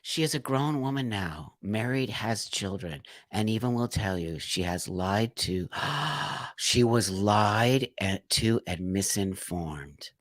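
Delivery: a real lump in the throat, barely getting the words out; choked up. Real, not acted. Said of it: "actually have tears in my eyes".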